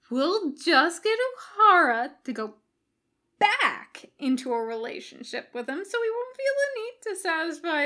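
high eager plotting; excited scheming. A narrator's reading. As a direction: pitch the plan proudly. Jim scheming, Bones questioning.

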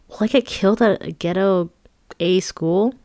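usual speaking voice

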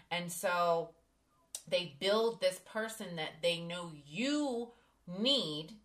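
ordinary delivery

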